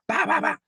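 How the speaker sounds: high bah bah bah gunfire noises; imitated gunshots. Narrator mimicking the old man shooting, high gun noises. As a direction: pop out the shots high and quick.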